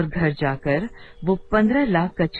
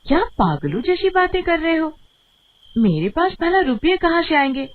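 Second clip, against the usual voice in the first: irritated scolding; 7 semitones higher than usual. Acted. Wife scolds husband asking money.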